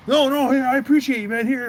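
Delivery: eager insisting. Retold or imitated. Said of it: "friend insists, retold".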